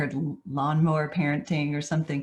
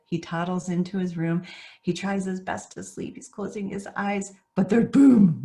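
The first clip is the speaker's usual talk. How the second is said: normal talking voice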